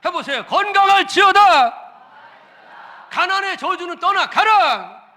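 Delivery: loud, high, slow commanding decree; loud proclamation. Shown as a demonstration. Pastor commanding curses to leave, shouting. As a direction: decree it with full force. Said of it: "크게 하세요".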